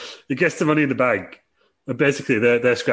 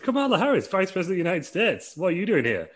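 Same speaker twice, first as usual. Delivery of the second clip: much higher, hammed-up astonished greeting; shocked Reginald voice; 10 semitones higher than usual. Reginald greets the Vice President in a high, hammed-up squeal. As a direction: squeak it out like a starstruck flunkey.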